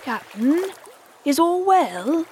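high, drawn-out timid question. A narrator's reading. Pirates checking on their brooding captain, timid. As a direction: ask cautiously, voice lifted. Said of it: "they asked timidly".

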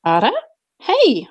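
bright high greeting, caught off guard; delighted surprise at seeing her; surprised and thrilled. Acted. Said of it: "I said, surprised and thrilled".

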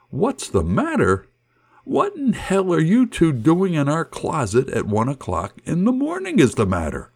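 confused shout. Retold or imitated. Parents shouting at boys in closet. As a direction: shout it back in disbelief. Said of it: "Kelly and I shouted".

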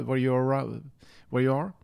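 ordinary talk

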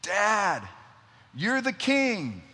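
high, drawn-out call down the stairs; imitated child's yell. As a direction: holler like a little girl summoning Dad. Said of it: "she yells down the stairs".

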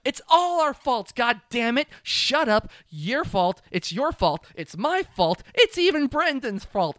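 hammed-up squabbling shout; quarrel shouting. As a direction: bicker in a rising, blaming yell. Boys quarrelling, acted.

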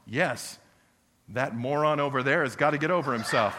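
loud comic outburst; mock shout. Pastor mimicking a member blaming someone, comic loud outburst. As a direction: bark it like a fed-up member. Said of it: "some of you are going".

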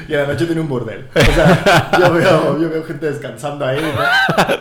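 cracking up, loud laughter; laughing loud. Real, not acted. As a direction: talk through big laughs. Laughing loudly telling story.

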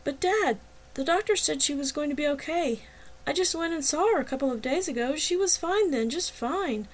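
a touch of crying in the protest; tearful protest. A narrator's reading. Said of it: "I cried".

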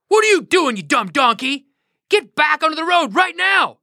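a mild angry shout; angry yell. Acted. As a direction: scold the donkey, fed up. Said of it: "he yells".

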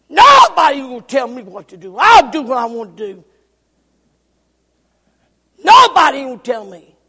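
loud, defiant yelling; furious stubborn refusal; defiant shout. Acted. Preacher voicing defiant sinner.

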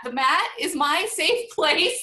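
emphatic. Real, not acted. Emphatic laughing insistence.

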